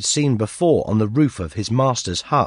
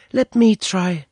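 put-on high eager girl's voice; a little girl keen to help; 9 semitones higher, slower than usual. A narrator's reading. Girl offering to break the lock, high girlish voice.

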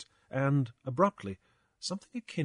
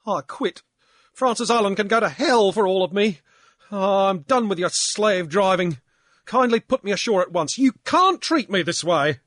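loud, high, indignant outburst; a furious sailor quitting on the spot; Pankburn, angry drunk; 9 semitones higher, 9 dB louder than usual. Acted. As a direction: rage at the captain. Pankburn quits in a rage.

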